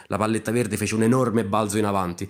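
plain reading voice

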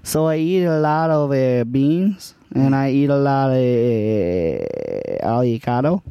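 light put-on Spanish accent. Improv scene as Juan.

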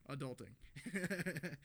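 slow, drawn-out posh British; perfect English. Retold or imitated. Joking dogs say it in perfect English, slow British drawl. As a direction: stretch it out like a proper Englishman. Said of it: "In perfect, like, English".

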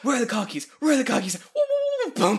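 high, loud goofy dog. Retold or imitated. Voicing the dog fetching car keys, high and loud. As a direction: yelp it like a dumb happy dog. Dog voiced in retold scene.

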